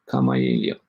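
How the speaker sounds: normal talking voice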